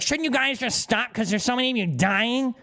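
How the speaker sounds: high-pitched, whiny-naive mimicry; mock naive Westerner. Mimicking Americans telling Ukrainians to make peace, mock-naive.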